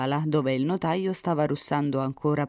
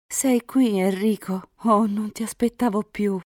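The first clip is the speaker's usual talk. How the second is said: put-on higher, louder exclamation for the girl; young heroine greeting her lover; 7 semitones higher, 4 dB louder than usual. A narrator's reading. Elisa to Enrico, who she no longer expected, higher greeting. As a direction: lift the voice high in sudden greeting.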